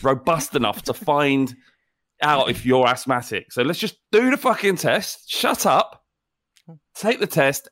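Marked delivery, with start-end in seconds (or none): 3.5-7.7 high, impatient snap; impatient official. Acted. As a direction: bark the order at him. Official loses patience.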